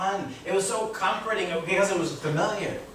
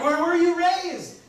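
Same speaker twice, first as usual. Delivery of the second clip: higher, slower, laughing puzzled question; mock puzzled questioner; 12 semitones higher, 4 dB louder, slower than usual. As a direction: play the baffled stranger, half laughing.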